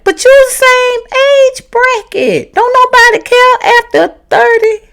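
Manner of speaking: way up high, disbelieving and sassy; high incredulous retort. Retold or imitated. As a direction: squeal it back in disbelief. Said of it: "I'm like".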